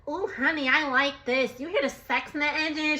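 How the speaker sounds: sultry nasal purr through the nose. Retold or imitated. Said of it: "she got that nasally voice".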